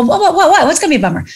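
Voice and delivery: high, laughing what-what-what; startled question. Retold or imitated. Young actress startled by her friend Bill, high and laughing. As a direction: blurt it out, half laughing in alarm. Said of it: "And I go".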